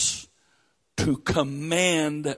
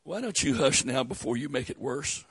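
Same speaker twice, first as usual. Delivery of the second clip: gentle hush; 4 semitones lower, 5 dB quieter, faster than usual. Retold or imitated. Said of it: "Jesus said".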